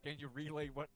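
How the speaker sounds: laughing request. Acted. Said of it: "I turn to Dirk and I go".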